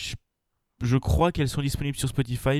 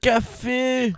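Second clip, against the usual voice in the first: squeaky high echo; high-pitched exclamation; 11 semitones higher, 3 dB louder than usual. Acted. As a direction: shriek the word café. Echoes in high voice.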